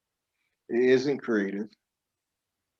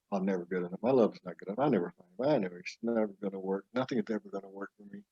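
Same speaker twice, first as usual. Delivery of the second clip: self-pitying ego; 4 semitones lower, 8 dB quieter, much faster than usual. Acted. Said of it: "less than".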